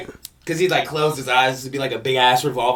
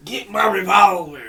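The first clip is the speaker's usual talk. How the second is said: Yosemite Sam impression; 8 semitones higher than usual.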